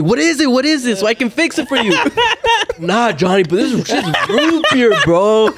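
high-pitched mock concern breaking into laughter; teasing imitation. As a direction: goad the angry friend, cracking up. Mimics friends teasing angry Johnny.

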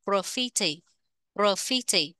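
"Graffiti" with the beat on FI; second-syllable stress. Shown as a demonstration. Teacher repeating the word for learners, stress on FI in "Graffiti". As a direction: lean on the middle of "Graffiti": gra-FI-ti. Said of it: "nhấn âm hai".

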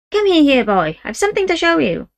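put-on the old woman beckons the boy inside. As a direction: voice the fierce old Romany woman, conspiratorial. Great-grandmother voiced.

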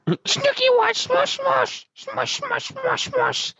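exaggerated squeaky Jersey girl; Snooki impression. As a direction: stretch it out in squeaky falsetto. Echoing Snooki bit.

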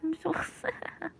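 talking through laughter; laughing. Real, not acted. Laughing while speaking.

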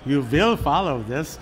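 imitated Viennese accent, WILL as "vill"; slow, deliberate mock Viennese accent, "vill" for will; Peter Drucker. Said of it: "hear Peter say this in his accent".

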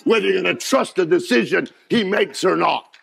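high, loud preaching over the organ; full-force preaching. Acted. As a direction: preach it hard, half-singing the fire.

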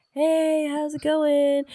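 mock greeting. Husband's greeting voiced in story.

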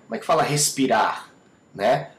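ordinary talk, nothing marked

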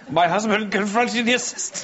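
high, breaking into laughter; fighting off the giggles; laughing. Real, not acted. Breaks up again mid-sentence.

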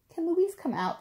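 high-pitched little friends asking to play; children's voices. A narrator's reading. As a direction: pipe up like eager young kids. Little friends call at the door.

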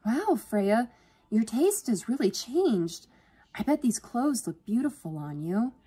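high, drawn-out gushing praise; admiring. A narrator's reading. Sister admiring Freya's new wardrobe, gushing. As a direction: gush with sisterly pride.

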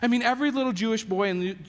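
usual speaking voice

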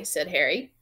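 ordinary delivery, nothing marked

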